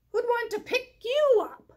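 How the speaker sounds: high, loud mocking jab; teasing sheep.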